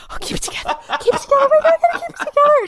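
breathless whisper rising to a high, fast squeal; barely contain yourself, then lose it laughing; starstruck freak-out. Retold or imitated. Fan meeting [name], starstruck freak-out.

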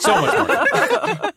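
very high, stretched out through laughter; laughing hard. Real, not acted. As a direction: squeal it out while cracking up. Host cracking up at the accents.

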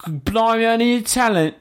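put-on a light, high Cockney accent in a panic; mock Cockney panic. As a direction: fret like a Cockney bloke who needs talent. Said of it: "Nothing but Cockney accents".

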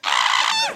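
high mock shriek; retold high scream. Reliving the scare in the dark, high mock shriek. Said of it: "I'm screaming".

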